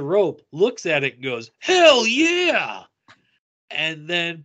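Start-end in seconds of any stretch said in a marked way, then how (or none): 1.6-2.8 high, loud imitated cheer; retold excited whoop. Actor grabbing the fan's rope, excited whoop.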